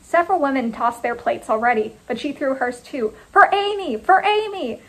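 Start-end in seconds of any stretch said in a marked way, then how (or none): 3.3-4.8 high, fervent chant; high-pitched battle cry; group rallying cry. A narrator's reading. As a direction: cry it out like a crowd joining in. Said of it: "a dozen women with a single voice".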